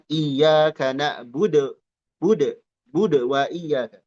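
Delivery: slow, na'budu with the final u swallowed; wrong sukun. Shown as a demonstration. Teacher mocks the swallowed vowel in na'budu. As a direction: clip the end of na'budu. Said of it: "Jangan jadi sukun".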